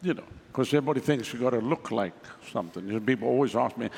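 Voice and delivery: ordinary talk, nothing marked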